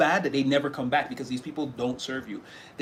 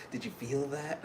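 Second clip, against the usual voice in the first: hushed thrill; 9 dB quieter than usual. Retold or imitated. Admirer after touching hands.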